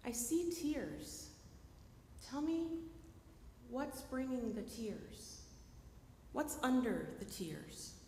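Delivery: slow, gentle and soft; slow caring invitation to talk. Shown as a demonstration. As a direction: coax them tenderly, very slowly. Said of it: "but I could say".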